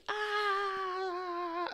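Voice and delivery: high, held "aah" drone; one long wailing monotone note; droning yell noise. Retold or imitated. Showing friends what mom's yelling sounds like, high droning noise. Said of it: "All I hear is".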